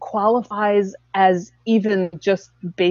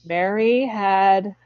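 very slow, plodding recitation; droning recital; much slower than usual. Shown as a demonstration. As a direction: recite it word by dragging word. Demonstrates dull, stiff recital.

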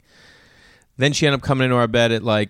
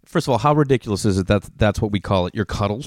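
normal talking voice

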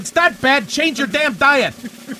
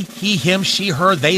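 ordinary delivery

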